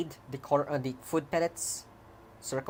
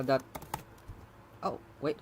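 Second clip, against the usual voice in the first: normal talking voice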